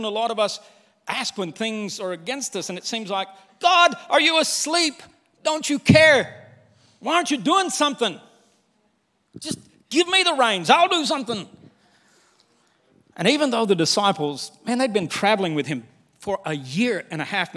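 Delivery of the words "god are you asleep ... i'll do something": high, pleading complaint to God; frustrated plea. Acted. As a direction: demand God wake up. Voicing believers' frustrated prayer.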